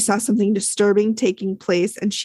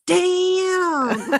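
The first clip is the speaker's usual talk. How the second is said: imitating Amy; 9 semitones higher than usual. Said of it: "and Amy goes".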